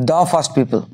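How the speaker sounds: দা as "duh" before first. Shown as a demonstration. Teacher applies the rule in revision, দা as "duh". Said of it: "consonant আছে".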